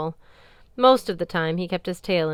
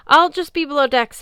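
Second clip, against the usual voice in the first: sobbing; 9 semitones higher, 9 dB louder than usual. Acted. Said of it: "he sobbed".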